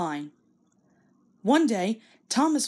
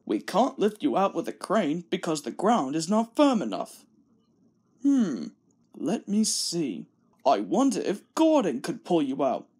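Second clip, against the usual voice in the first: lower, measured boss voice; thoughtful Fat Controller; 3 semitones lower than usual. Acted. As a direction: Fat Controller planning the rescue. Fat Controller thinking aloud.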